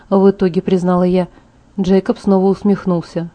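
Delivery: usual speaking voice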